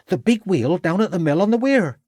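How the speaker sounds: high-pitched, cocky servant lad; self-important boy. A narrator's reading. Boy informs Ravenscroft, growing in self-importance. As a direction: announce it high, like a lad who knows. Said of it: "growing in self-importance".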